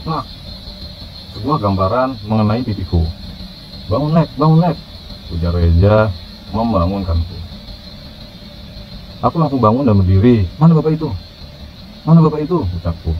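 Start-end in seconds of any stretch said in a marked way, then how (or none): 3.9-4.8 higher, insistent call; urgent waking call. A narrator's reading. Friend waking the dreamer. 10.6-12.7 high, startled demand; panicked question. A narrator's reading. Wakes up in panic from nightmare.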